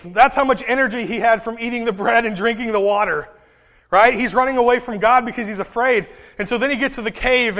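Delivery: ordinary delivery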